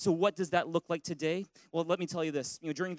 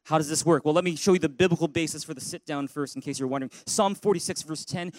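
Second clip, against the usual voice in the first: ordinary delivery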